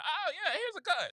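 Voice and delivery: high-pitched, breezy mock generosity; mock welcome. Mock host welcoming a newcomer with a gun, mock welcome. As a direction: hand it over like a party favor.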